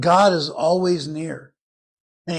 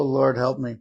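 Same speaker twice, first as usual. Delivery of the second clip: normal talking voice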